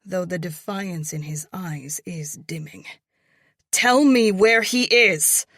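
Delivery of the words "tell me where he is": screamed, higher and louder; screaming. A narrator's reading. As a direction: scream the demand. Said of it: "I scream".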